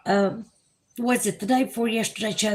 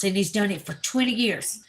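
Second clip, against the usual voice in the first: ordinary talk, nothing marked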